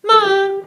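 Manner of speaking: high, loud "Ma!"; loud falling-tone syllable; fourth tone "ma". Shown as a demonstration. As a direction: fire out a sharp high "Ma!". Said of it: "Oh, he's really angry".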